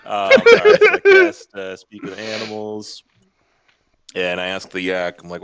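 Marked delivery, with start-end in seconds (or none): 0.1-1.6 squeaky joke voice. Pretend.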